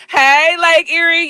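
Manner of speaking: normal talking voice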